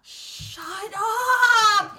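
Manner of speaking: loud, high "shut up!"; embarrassed shout. Real, not acted. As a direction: yell it, mortified. Friend outs her secret.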